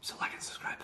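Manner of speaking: whispered aside. Real, not acted. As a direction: whisper it quickly.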